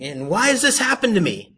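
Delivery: high, loud hammed-up complaint; mock whining. People asking God why, loud mock whining. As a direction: wail it like a put-on victim.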